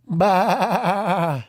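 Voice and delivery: high, wavering long baa; quavering sheep noise, pitched high; sheep bleat. Acted. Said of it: "A sheep says".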